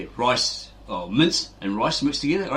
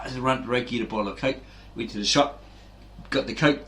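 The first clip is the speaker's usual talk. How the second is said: ordinary delivery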